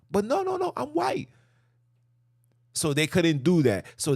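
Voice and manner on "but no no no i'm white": squeaky, high protest; high insistent denial. Retold or imitated. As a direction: pitch it up, protesting.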